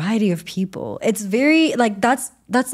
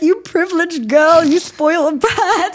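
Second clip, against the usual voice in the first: high, slow sneering taunt; mock the spoiled girl with contempt; scornful mocking; 9 semitones higher, 5 dB louder than usual. People mocking her online.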